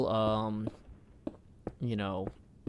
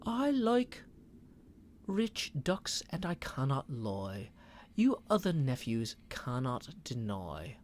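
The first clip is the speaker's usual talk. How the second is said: put-on second go at a London Thames accent, slower and softer; a more careful Thames accent, London vowels; a retried British Thames accent, slower; 7 dB quieter, slower than usual. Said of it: "Thames accent".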